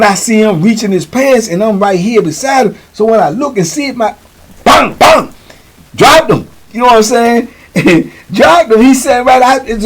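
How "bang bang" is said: loud, high mock punch noises; imitated blows. Telling how he struck the man, loud imitated blows. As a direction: punch out two sharp imitated impacts.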